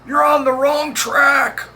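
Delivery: high, loud shouted warning; motorman's yell. A narrator's reading. Motorman shouting from his window at the dozing engineer, loud high yell. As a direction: yell it up high over the train noise. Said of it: "leans out his small window and yells".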